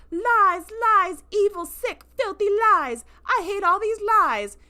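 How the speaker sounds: high, loud, slow raging denial; furious ranting. Retold or imitated. Braca screams denial at Ake's revelation, furious. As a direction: rant like a child in a tantrum.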